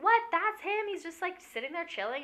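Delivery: high, incredulous questions; can't believe the rich owner is just sitting there; shocked disbelief. Retold or imitated. Friend asking in disbelief about the hotel owner, shocked.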